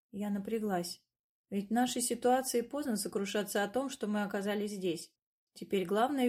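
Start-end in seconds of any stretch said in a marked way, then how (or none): none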